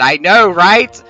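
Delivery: loud, high excited outburst; excited shout. Real, not acted. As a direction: yell it out in delight. Player exclaims over cutscene.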